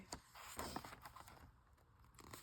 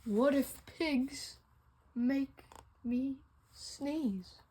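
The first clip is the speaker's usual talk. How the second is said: slow dread; 3 semitones lower, slower than usual. Acted. Dawning dread, slowed down.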